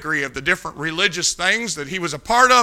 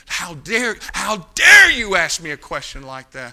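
mock offended protest; imitated indignation; 4 dB louder than usual. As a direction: act out an affronted man, only moderately raised. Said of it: "he got so angry with me".

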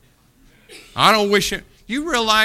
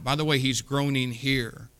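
ordinary talk

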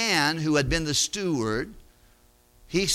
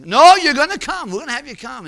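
insistent invite; 8 semitones higher, 10 dB louder, faster than usual. Acted. Debtor insists on dinner invitation.

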